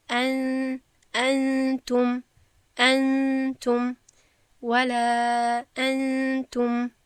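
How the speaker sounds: انتم drawn out very slowly, nun hummed through the nose; ولا انتم recited slow with nasal ikhfa; hidden nun recited. Shown as a demonstration. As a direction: chant انتم with a long nasal ghunna.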